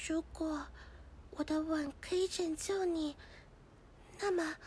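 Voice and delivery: very soft, low, halting vow; tender. Acted. Girl offering a kiss to save the frog, tenderly. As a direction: murmur it gently, with pauses.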